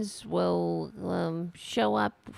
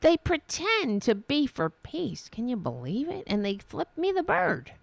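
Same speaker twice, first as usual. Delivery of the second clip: higher, incredulous parody of a politician; mock indignation; 5 semitones higher than usual. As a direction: mimic her scandalized complaint. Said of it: "She's really indignant".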